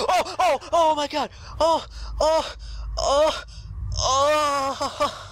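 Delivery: terrified yelps at a jump scare; frightened cries. Real, not acted. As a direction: shriek in real fright.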